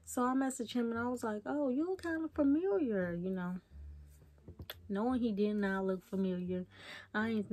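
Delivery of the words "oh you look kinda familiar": higher, lilting conversational opener; feigned recognition. Retold or imitated. Texting a stranger to start a conversation, feigned recognition. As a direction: coyly pretend to recognize someone.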